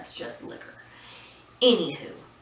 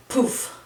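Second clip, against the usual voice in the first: short, loud, higher puff of a sound; imitated poof; 7 semitones higher, 7 dB louder than usual. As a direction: pop out a sudden "poof". Imitates the anticlimactic disintegration.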